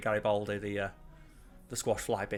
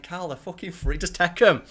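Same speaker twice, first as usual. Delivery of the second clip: higher, louder, urging; telling Carl to just take the free biscuits, higher and louder; urging insistence; 6 semitones higher, 7 dB louder than usual. Retold or imitated. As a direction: push it on him, louder. Mocking coworker pleading.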